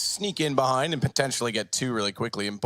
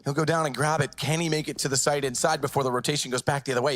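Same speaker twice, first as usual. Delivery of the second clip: ordinary delivery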